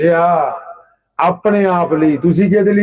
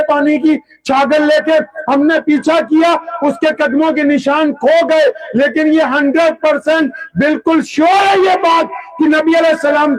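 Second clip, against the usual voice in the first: preaching at full force; 9 semitones higher than usual. Acted. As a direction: pour the spy's report out in a fervent preaching voice. Preacher acting out spy's report.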